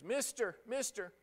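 put-on high, thin call of a weeping girl; a lightly voiced girl crying out mister; a blind girl calling after the man who helped her; pleading girl's call.

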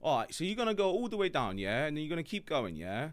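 a hint of a London accent, every phrase lilting up; English question-like intonation. Shown as a demonstration. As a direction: give directions like a Londoner, ending each bit with yeah?. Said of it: "you wanna sound like an Englishman".

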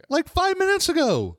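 very high, insistent exclamation; exasperated. Real, not acted. Host insists to co-host the topic changed, exasperated. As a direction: squeak it out in exasperation.

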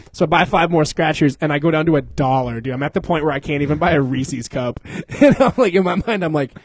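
normal talking voice

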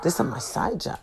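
hushed confiding whisper. Retold or imitated. Repairman whispering to her about his side job. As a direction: breathe it like a secret.

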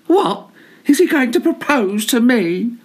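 high, startled squeak of a voice; surprised scissors. Acted. Scissors hearing the collar may propose, high and startled. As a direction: gasp it out, thrilled and shocked.

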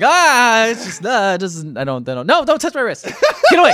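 high, yelping squeamishness with a laugh; squeamish squirming. Real, not acted. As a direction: recoil in half-laughing discomfort. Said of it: "this made me very uncomfortable".

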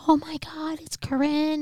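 high-pitched delighted disbelief; amazed relatives. Retold or imitated. Relatives greet long-lost child, imitated amazement.

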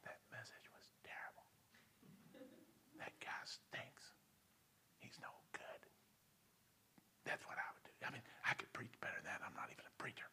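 hushed devilish whisper campaign. Retold or imitated. Devil whispering gossip about the preacher, whispered. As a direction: whisper it like gossip spread in the pews. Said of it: "I would begin with a campaign of whispers".

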